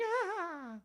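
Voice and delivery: high gleeful cackle; squeaky mocking laugh; high fool's cackle. Fool's laugh after taunt.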